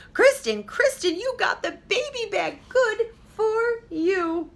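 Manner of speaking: high, slow, gushing mother's voice; overjoyed mother. Acted. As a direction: praise her daughter with great joy. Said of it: "her mother said".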